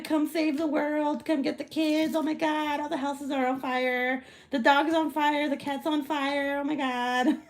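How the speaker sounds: high, hammed-up hysterical wail; mocking panic. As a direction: parody a frantic doomsday phone call. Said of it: "He's making fun of me".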